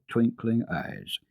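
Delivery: ordinary delivery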